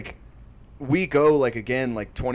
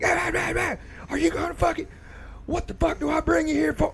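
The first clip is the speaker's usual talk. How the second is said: very high-pitched mock tirade; angry dad impression. Dad up yelling at his sparring kid, mock angry. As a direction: imitate an exasperated dad berating his son.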